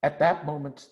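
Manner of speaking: ordinary delivery, nothing marked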